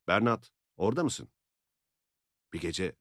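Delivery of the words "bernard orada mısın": loud, high call to the coop. A narrator's reading. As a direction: call the hen by name, loud. Yoklamada tavuklara seslenme. (tavuklara yüksek sesle seslenme)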